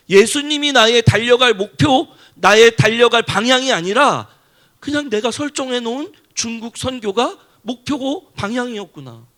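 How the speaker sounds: loud, ringing declaration; forceful preaching. Acted. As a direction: proclaim it to the whole room. Preaching climax.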